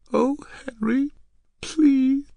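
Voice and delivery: high, airy, faltering plea; near tears. Acted. Girl begging Henry to stop, near tears. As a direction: beg as if about to cry. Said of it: "She sounded very near to tears".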